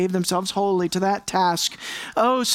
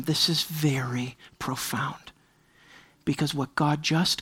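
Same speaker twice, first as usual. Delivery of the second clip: slow hushed aside; slow, intimate whisper to the congregation; 5 semitones lower, 6 dB quieter than usual. Real, not acted. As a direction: lean in and whisper it. Preacher drops to whisper.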